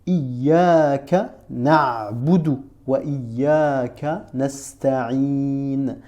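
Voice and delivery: the verse Iyyaka na'budu wa iyyaka nasta'in drawn out very slowly; slow recitation. Shown as a demonstration. Teacher quoting the Fatiha to the pupil, slow.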